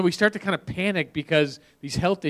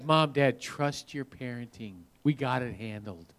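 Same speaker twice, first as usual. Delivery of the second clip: low, soft, slow mock reassurance; lower, quieter know-it-all assurance; overconfident teen; 6 semitones lower, 8 dB quieter, slower than usual. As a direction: imitate a cocky teen calming parents down. Acting overconfident teenagers to parents.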